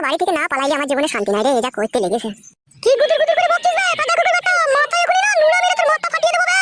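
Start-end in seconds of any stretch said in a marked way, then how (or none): 2.8-6.6 high-pitched scolding tirade; angry threat. Acted. Wife threatening to crack her husband's head, furious high-pitched scolding. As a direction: lay into him in a high, furious voice.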